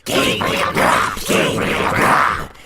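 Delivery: loud, drawn-out screech of the name; wild exclaiming voices. A narrator's reading. Wild voices answer the goblin, screeching. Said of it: "exclaimed the wild voices again".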